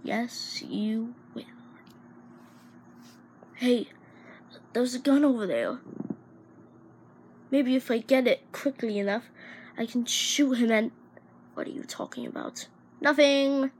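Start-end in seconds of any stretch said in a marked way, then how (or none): 0.0-1.4 breathy muttered agreement; whisper. Acted. Whispered aside. 11.5-12.7 fast unvoiced whisper. Whispered suspicious question.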